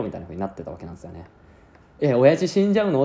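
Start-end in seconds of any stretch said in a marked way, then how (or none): 2.0-3.0 high, louder alarmed question; sudden fear for his father; shocked. Retold or imitated. Viewer imagining the son's shock, alarmed.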